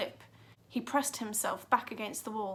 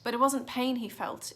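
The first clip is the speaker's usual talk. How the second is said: ordinary delivery, nothing marked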